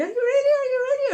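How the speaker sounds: high, loud squeal; excited shout. Real, not acted. Child squealing to the baby before reading time, loud and high. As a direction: squeal the question, thrilled.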